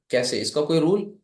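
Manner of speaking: ordinary talk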